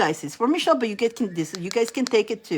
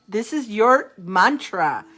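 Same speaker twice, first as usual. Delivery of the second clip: ordinary talk, nothing marked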